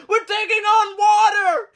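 high shouted alarm like a sinking ship; joking that the car is a ship, high shout; mock ship alarm shout. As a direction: yell it like a sailor in trouble. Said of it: "I was like".

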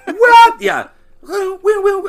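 high, loud woo woo; cartoon woo sound. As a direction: mimic the clue sound effect. Imitating Psych clue sound effect.

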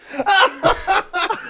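high helpless laughter; hearty laugh. Real, not acted. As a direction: burst out laughing.

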